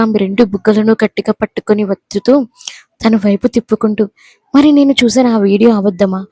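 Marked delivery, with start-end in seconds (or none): none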